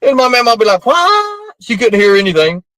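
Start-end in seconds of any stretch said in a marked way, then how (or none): 0.9-1.5 very high sharp what; mock puzzled what. Mama who couldn't hear anything, high puzzled what. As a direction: squeak it out, confused.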